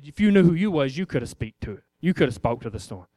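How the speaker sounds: gently scold, then swell on the last line; preacher voicing Jesus rebuking his disciples, low then rising; low rebuke. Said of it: "He said".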